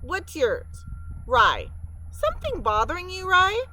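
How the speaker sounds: high, bright and quick; a chatty beaver greeting the mice; friendly beaver Clara. Acted. Clara the beaver introduces herself.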